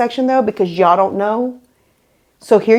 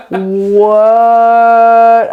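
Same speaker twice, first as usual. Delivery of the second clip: a long, drawn-out stunned what, a bit louder; shocked what; 6 dB louder than usual. Retold or imitated. Retelling the moment the clerk handed over the keys, stunned. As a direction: let the disbelief stretch out the word.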